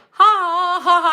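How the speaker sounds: high, loud mock "ha ha ha"; big put-on guffaw; imitated laugh. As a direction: mimic someone laughing it off. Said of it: "And he's like".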